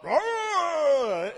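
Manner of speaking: huge high roaring yell; a hammed-up lion's bellow; mock lion roar. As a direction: roar like a showing-off male lion. Acting the tough male lion.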